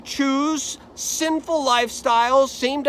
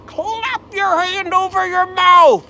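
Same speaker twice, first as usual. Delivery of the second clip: incredulous outcry; 8 semitones higher, 6 dB louder than usual. Acted. Job challenges his friends.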